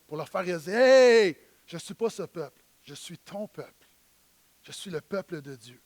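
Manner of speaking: ordinary talk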